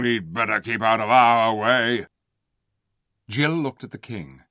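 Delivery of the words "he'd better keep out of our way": growling. A narrator's reading. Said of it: "growled the beasts".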